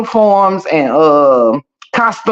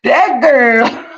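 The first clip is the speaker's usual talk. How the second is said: laughing; 3 semitones higher, much slower than usual. Real, not acted. Slow, dramatic pronouncement while laughing.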